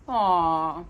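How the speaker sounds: plain reading voice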